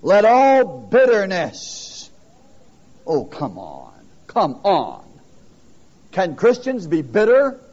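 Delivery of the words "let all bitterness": "all" stressed, each word drawn out slowly; very slow reading. Shown as a demonstration. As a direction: spell out the verse word by word, leaning on "all". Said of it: "Well, look at it".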